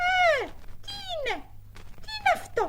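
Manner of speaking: high startled question. Acted. Seeker startled by a voice in the sack. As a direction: jump at the voice.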